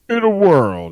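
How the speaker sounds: loud epic trailer opener; movie trailer voice. Retold or imitated. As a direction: belt it like the trailer guy. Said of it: "he keeps trying to do".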